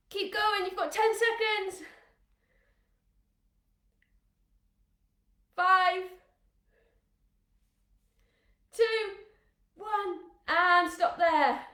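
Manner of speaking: loud, high countdown call. Real, not acted. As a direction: shout the seconds across the room. Coach calling out countdown.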